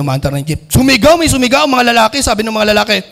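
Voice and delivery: ordinary talk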